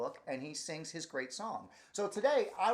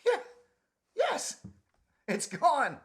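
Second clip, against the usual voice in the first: squeaky relief; 9 dB louder, much slower than usual. Acted. Relief before the sneeze.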